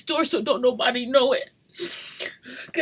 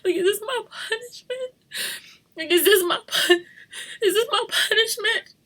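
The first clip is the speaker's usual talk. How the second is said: high, breaking cry to God; pleading through sobs, pitch climbing; sobbing plea; 9 semitones higher than usual. Real, not acted. Mother asking God if this is punishment, sobbing.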